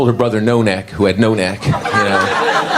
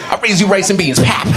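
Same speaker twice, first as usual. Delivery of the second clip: higher-pitched mock betting call; gambler raising; 8 semitones higher than usual. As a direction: throw down a raise in the game. Mimicking gamblers raising bets.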